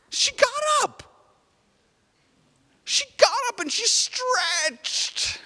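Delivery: very high, thrilled storytelling; teacher thrilled the dead girl rose, high and excited; delighted excitement. Acted. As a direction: squeal it with joy, slowing on the stretch. Girl rises, told with delight.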